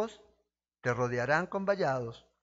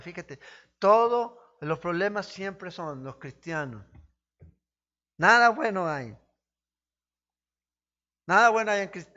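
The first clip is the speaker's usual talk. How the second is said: shouting from the pulpit, much louder and higher; fiery preaching; 4 semitones higher, 8 dB louder than usual. Acted. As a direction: preach it hard, voice cracking loud. Preaching at full force. (predicación a gritos, fuerte y aguda)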